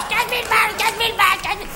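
high-pitched mock hysteria, repeating obsessively; imitating hysterical boss. Employee mimicking boss ranting over money, hysterical. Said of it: "sur un mode complètement hystérique".

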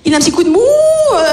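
acted-out panicked improvising; mock panic. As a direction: fumble for an answer in pretend panic. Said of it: "Je panique".

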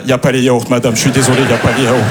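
lightly mocked hostage statement; hostage on video. Shop worker filmed as proof, lightly imitating a hostage. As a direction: recite it like a captive forced to confess. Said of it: "il est comme les otages".

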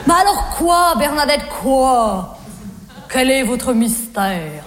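insistent suitor sinking low and slow; Jean-Loup. Retold or imitated. Voicing Jean-Loup demanding an explanation. (soupirant insistant, voix de plus en plus grave)

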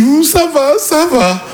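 high, wavering voice of a friend holding back tears; crying friend. Retold or imitated. Drunk friend Julien fakes being fine, high wobbly voice. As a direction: play the drunk friend denying he's upset.

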